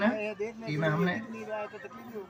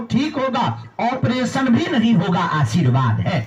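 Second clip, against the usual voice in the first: raised, declaiming the diagnosis; oracle-style pronouncement; 8 semitones higher, 4 dB louder than usual. Acted. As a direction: proclaim each line like a revealed verdict. Guru reads out the written verdict.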